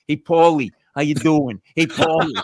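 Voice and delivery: loud, hammed-up goodfella hello; imitated Italian-American tough-guy greeting; mock Italian-American greeting. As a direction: greet him like a movie wiseguy, Italian-American accent. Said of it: "he goes like this".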